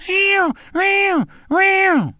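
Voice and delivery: high drawn-out cat yowl; very high, falling meows; panicked cat meows. Acted. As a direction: yowl like a house cat in distress. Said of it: "Buster in there going".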